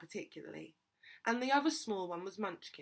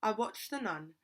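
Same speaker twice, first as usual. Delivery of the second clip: plain reading voice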